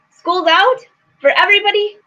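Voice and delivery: high incredulous rise on out; surprised question. Real, not acted. Reacting to chat comment.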